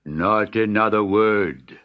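slow, loud royal command; grand languid royal commands. Acted. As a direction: decree it like a magnanimous king. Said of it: "cried the Sultan".

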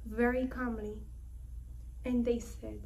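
ordinary talk, nothing marked

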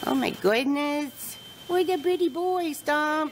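high, delighted cooing at a horse; cooing pet talk. Real, not acted. Owner cooing over her shiny horse Stomp, high and fond.

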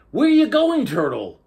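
high, loud little fish voice; squeaky excited fish calling out; clownfish voice. A narrator's reading. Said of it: "asked the clownfish".

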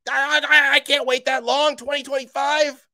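high mock whine of an impatient fan. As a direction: whine like a fan who can't wait that long. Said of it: "some of you are like".

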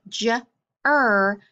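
"j-er" slowly, as separate sounds; short "j", pause, long "er"; sounded out. Shown as a demonstration. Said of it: "sound it out".

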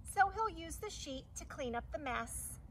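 ordinary delivery

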